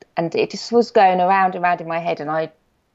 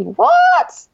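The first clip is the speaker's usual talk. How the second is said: a squeaky high burst of disbelief, louder; shocked what; 5 dB louder than usual. Retold or imitated. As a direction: yelp it in sheer surprise, much higher. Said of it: "And I was totally".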